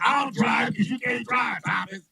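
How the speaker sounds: mock shout. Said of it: "Angry like".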